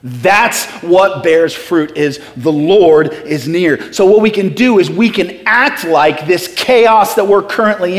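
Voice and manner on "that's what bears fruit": ordinary talk, nothing marked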